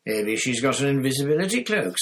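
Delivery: loud, high, heated suggestion; angry. A narrator's reading. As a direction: snap it out in a temper. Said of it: "in his anger".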